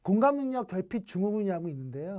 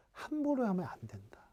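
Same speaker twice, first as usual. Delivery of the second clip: a hushed, confiding warning; whisper; 2 semitones higher, 6 dB quieter than usual. Real, not acted. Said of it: "unvoiced whisper". (속삭이듯 조용히 하는 경고)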